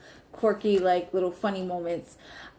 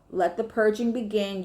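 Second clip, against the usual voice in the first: normal talking voice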